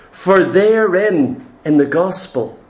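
low, slow and hushed, weighing each phrase; low reverent scripture recitation; solemn scripture reading. Acted. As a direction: read the verse like a sacred revelation.

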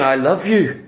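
low, soft and tender; loving voice. Retold or imitated. The seductive lady telling Joseph tenderly, a low loving voice. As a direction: speak it as pure love, not anger. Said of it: "She's not angry with him".

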